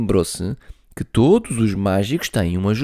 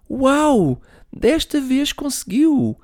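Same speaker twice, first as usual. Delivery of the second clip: put-on high, slow pleading little girl; sweetly begging her brother, very high; little sister voice; slower than usual. Millie voice, amazed. (voz de menina a pedir, muito aguda)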